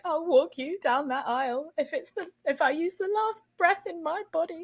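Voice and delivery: high, hammed-up declaration; dramatic dad. Dad vowing to walk his engaged daughter, hammed-up dramatic. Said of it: "he was so dramatic".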